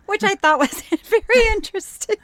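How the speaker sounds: high, laughter breaking up the words; real giggles cracking the sentence; can't say it without laughing. Real, not acted. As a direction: try to get it out through real laughter. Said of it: "can't say it without laughing".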